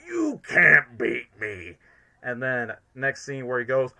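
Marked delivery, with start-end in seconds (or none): 0.1-1.8 slow, loud villain boast; hammy, drawn-out villain defiance; Eggman. Retold or imitated. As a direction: stretch it out with theatrical force. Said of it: "embracing more of the Eggman line".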